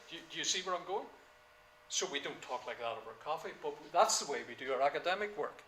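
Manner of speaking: normal talking voice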